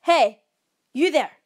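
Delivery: cautious shout. A narrator's reading. Camper trying to scare off an animal, cautious shout. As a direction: call out sharply at the animal. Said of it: "I cautiously shouted at it".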